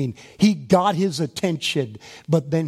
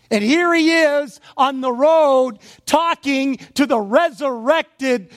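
much higher and louder, building proclamation; preaching at full force; 9 semitones higher, 5 dB louder than usual. Real, not acted. Preacher to congregation, proclaiming the resurrected Lord at full force. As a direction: preach it out with rising fire. Sermon climax, rising intensity.

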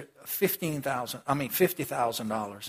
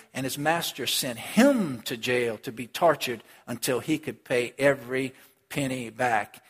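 ordinary talk, nothing marked

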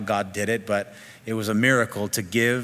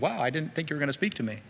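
quick, higher, softer surprised reaction; mock astonishment; 5 semitones higher, 6 dB quieter, faster than usual. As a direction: act taken aback, in a hurried hush. Acting out startled answer to God.

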